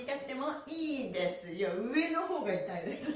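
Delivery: normal talking voice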